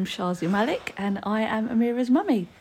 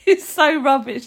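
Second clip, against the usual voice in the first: loud laughing through words; 4 semitones higher, 10 dB louder than usual. Real, not acted. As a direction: laugh as you speak. Mum laughing at bad joke.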